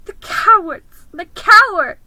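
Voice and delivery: high, loud sobbing cry; sobbing outburst. Acted. Susan sobbing at the crowd, high and loud. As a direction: cry out in tearful anger. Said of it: "sobbed Susan".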